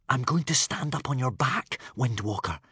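plain reading voice

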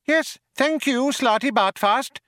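very high, sharp mouse voice. A narrator's reading. Mouse dismisses old man, sharply. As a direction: cut him off sharply as a mouse. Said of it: "said Benji Mouse sharply".

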